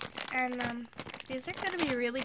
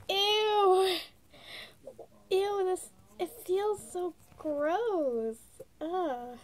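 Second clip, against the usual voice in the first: disgusted; 5 semitones higher, much slower than usual. Real, not acted. Touching gross plastic toy.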